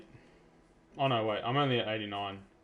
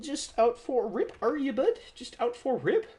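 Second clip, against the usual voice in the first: much higher, louder cartoon squeak; squeaky teasing; 13 semitones higher, 5 dB louder than usual. Player teasing Simon again, high squeaky cartoon voice. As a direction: squeal the tease like a chipmunk. Repeats tease in new voice.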